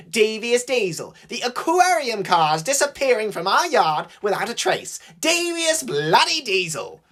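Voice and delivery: high, theatrical accusations; hammed-up, high-pitched ridicule; mocking rant. A narrator's reading. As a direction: reel off each theory in high mockery. James mockingly lists Duck's conspiracy theories.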